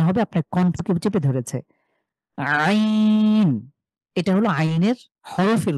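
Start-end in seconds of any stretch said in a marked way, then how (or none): 2.4-3.7 আইন pressed from mid-throat, long and drawn out; throat-pressed Ain. Shown as a demonstration. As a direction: squeeze আইন from the middle of the throat. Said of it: "কণ্ঠের মাঝখান থেকে চাপ দিয়ে".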